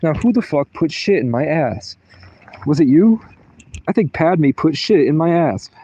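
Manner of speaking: playful accusing rant in mom's voice; imitating mom. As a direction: do mom hunting for the culprit. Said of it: "That's how she talks".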